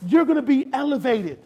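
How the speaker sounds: high, loud, emphatic sermon delivery; full-force preaching. Acted. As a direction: preach it hard to the room.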